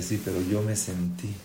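low, soft, wounded reply; acted hurt, voice dropped. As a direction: answer quietly, as someone offended. Offended person's hurt reply.

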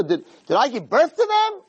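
high-pitched incredulous retort; indignant question. Retold or imitated. Moshe questioning Hashem about the people, indignant. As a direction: act out aggrieved disbelief, pitch jumping up. Acts Moshe's protest to God.

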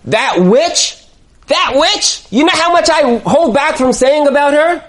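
acted outburst, pitch climbing high; exasperated venting. Wife to husband about his mother, indignant venting. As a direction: vent like a fed-up daughter-in-law.

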